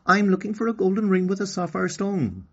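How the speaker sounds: put-on a small girl asking politely; high girl voice; little girl Okani. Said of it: "Okani replied".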